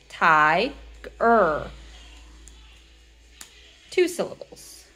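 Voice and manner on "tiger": "Tiger" slowly broken into two beats; syllable by syllable. Shown as a demonstration. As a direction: stretch and separate both syllables of "Tiger". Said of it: "Tiger has two syllables".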